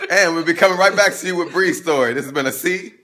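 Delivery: a lively TV host wrapping up; host announcer voice. Acted. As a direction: announce the next segment like a show host. Host sign-off.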